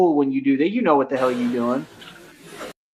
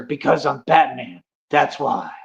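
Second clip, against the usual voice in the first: Batman; 2 semitones lower than usual. Acted. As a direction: answer as a self-important masked hero. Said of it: "Why are you talking like that".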